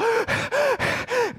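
very high breathless gasp for air; desperate gasp on surfacing. Acted. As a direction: gulp air as if just out of the water. Surfacing after being held underwater.

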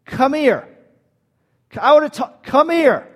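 commanding. Retold or imitated. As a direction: order them out, no asking. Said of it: "he's commanding".

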